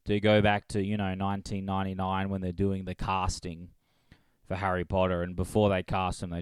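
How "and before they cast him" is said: ordinary talk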